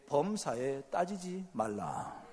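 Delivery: mock solemn verse, drawn out; solemn slow reading. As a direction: read it slowly like scripture. Joke verse read solemnly. (느리고 엄숙하게 낭독하듯)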